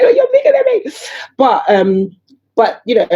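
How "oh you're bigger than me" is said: much higher, squeaky mock voice; mock squeal. Teasing her tall son, squeaky high voice. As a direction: squeak it like a tiny mum. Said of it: "I'm like".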